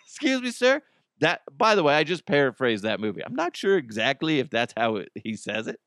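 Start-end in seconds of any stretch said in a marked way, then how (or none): 0.1-0.8 mock ranger voice, pitched way up; high-pitched interruption. Rangers interrupting a man on a picnic blanket, high mock call. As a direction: call out in a squeaky high register.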